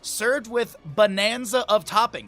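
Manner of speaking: playful restaurant menu pitch; mock ad read. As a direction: sell the baked potato like a commercial. Reads menu description aloud.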